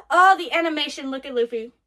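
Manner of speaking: ordinary talk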